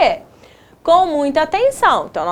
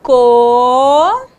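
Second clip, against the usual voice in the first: "Co" held long and louder, rising; syllable dictation; 5 dB louder than usual. Shown as a demonstration. Teacher dictating a syllable to pupils, drawn-out dictation. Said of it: "Escreva a sílaba ditada".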